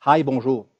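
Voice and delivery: imitated greeting. Acts out bilingual shop greeting. (salutation imitée, lancée plus fort)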